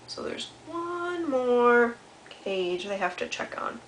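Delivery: plain reading voice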